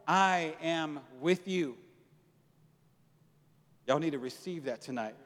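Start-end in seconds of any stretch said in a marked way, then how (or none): 0.1-1.8 each word slow and weighted; slow emphasis. Acted. Pastor pressing the promise, slow. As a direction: lean on I am with you slowly. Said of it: "slow emphasis".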